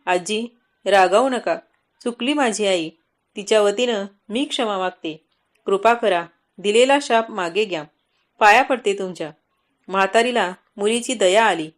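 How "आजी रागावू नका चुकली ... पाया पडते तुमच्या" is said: plain reading voice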